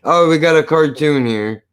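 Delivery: usual speaking voice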